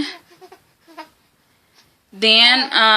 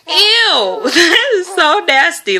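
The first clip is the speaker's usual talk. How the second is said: laughing disgust; 8 semitones higher, slower than usual. Retold or imitated. Seeing baby's head in mirror.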